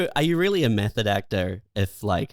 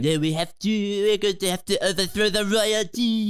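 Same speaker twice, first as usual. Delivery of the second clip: put-on French accent; 7 semitones higher than usual. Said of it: "Russian accent ... same problem with French".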